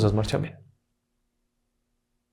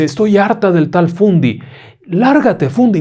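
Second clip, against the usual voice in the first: high, loud dismissive yell; mocking fed-up mother; 7 semitones higher, 4 dB louder, slower than usual. A narrator's reading. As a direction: brush off the threat scornfully. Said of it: "La mamá se ríe y le dice".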